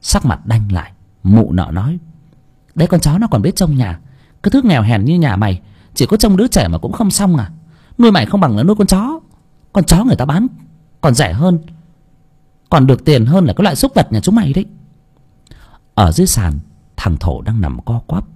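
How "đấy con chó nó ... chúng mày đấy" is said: contemptuous scolding. Acted. Mụ chủ chửi mắng người hầu.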